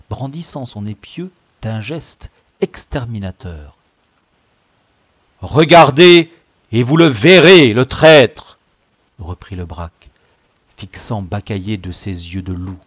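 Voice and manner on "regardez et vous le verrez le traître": raised, forceful accusing voice; loud denunciation. Acted. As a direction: point out the traitor at full voice. Chief loudly denounces the traitor. (dénoncer d'une voix forte)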